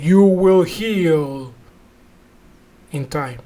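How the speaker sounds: very slow, portentous pronouncement, sinking at the end; solemn faith healer. Retold or imitated. Healer answering a paralyzed man, slow mock-solemn voice. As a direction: imitate a healer, long pause before the last words.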